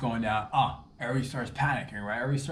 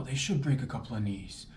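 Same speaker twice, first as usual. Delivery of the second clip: ordinary delivery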